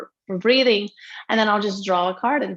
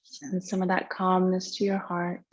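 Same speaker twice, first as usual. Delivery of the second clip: guided meditation. Real, not acted. Yoga teacher settling students into tonight's practice, guided meditation. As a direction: lead them gently into breath awareness, unhurried.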